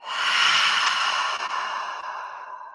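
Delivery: long, breathy exhale; long sigh. Shown as a demonstration. Yoga teacher breathing out with the class, long sigh. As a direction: let a big breath out slowly. Demonstrates a deep cleansing breath.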